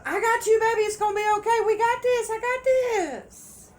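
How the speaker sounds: high, loud imitation of a partner promising it's fine; mock sweet-talker. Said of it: "they still tell".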